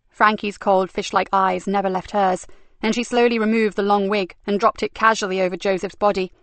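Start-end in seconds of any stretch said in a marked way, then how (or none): none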